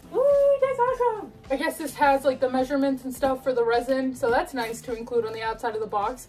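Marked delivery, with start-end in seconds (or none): 0.1-1.3 high, thrilled squeal; a real burst of fan excitement; excited squeal. Real, not acted. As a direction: squeal with delight at the box. Excited over resin statue box.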